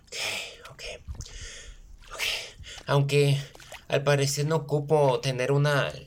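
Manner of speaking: usual speaking voice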